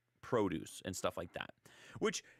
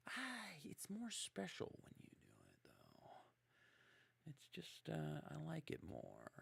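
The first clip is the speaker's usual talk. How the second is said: much softer, falsetto then breathy; wheedling; 7 semitones higher, 15 dB quieter, slower than usual. Retold or imitated. Husband sweet-talking his wife into cooking, soft and breathy. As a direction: coax her sweetly, almost whispered.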